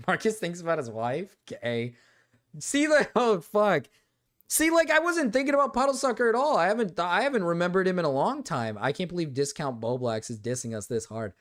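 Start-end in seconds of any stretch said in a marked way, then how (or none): none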